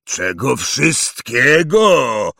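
slow, loud thundering echo. Acted. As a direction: thunder the words back. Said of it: "zagrzmiał Hagrid".

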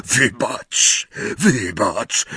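breathless, cowed apology; panting, faltering words; gasped. A narrator's reading. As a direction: gasp it out while backing off. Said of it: "wydyszał łysy czarodziej".

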